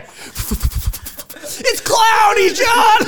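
high, drawn-out reporter shouting over noise; hammed-up traffic copter reporter; weather chopper reporter. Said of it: "Steve and the weather chopper".

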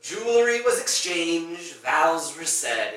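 indignant villain defending his marriage; loud insistence. Acted. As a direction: spell it out loudly, point by point.

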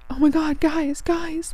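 high, mock panicked cry of distress; retold near-tears panic. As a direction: imitate a friend on the verge of tears. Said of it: "almost in tears".

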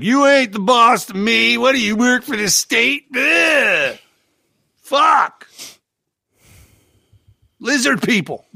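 high, slow dim-witted yokel voice. Retold or imitated. Mocking the yokels who'd answer him back, in a high, slow yokel voice. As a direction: sneer it like a clueless local. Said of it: "something fucking classy and smart like".

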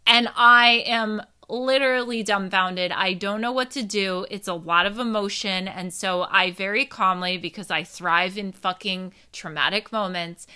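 ordinary talk

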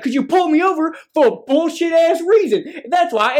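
high, indignant comeback with some amusement; retold anger. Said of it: "getting so mad".

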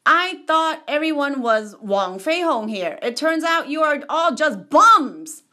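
acted rude challenger; rude taunt. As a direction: jeer at them as the rude challenger. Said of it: "this guy's rude".

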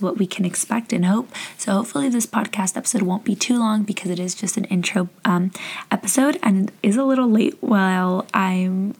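ordinary talk

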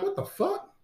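high, incredulous outburst; stunned what the fuck. Retold or imitated. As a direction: shoot way up high in disbelief. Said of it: "I'm like".

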